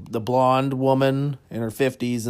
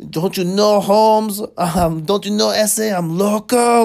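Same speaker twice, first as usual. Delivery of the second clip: worked-up Mexican guy; 9 semitones higher, 7 dB louder than usual. Retold or imitated. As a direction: imitate the Mexican guy getting riled up in a thick Mexican accent. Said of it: "when he got all worked up".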